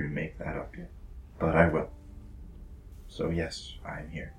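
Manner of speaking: a whispered pledge of loyalty; hushed vow. Acted. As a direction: give the promise on a breath. Quiet in-character vow.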